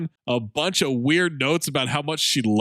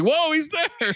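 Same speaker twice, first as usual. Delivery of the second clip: mock surprise; 13 semitones higher, slower than usual. Acting out surprised recognition.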